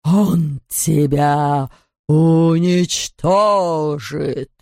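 ghost voice. Acted. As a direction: drag out the menace like a spectre. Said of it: "ответил Непроприв".

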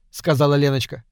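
plain reading voice

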